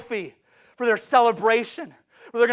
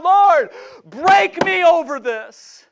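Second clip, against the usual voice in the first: high, loud, drawn-out plea to God; crying out; 7 semitones higher, 9 dB louder, much slower than usual. Retold or imitated. Preacher retells his plea this afternoon, crying out. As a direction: cry it out slowly from the pulpit. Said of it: "I just cry out to God".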